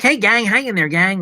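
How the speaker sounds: a lightly voiced relaxed director cheering up sweaty extras; breezy director impression. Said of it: "And he's like".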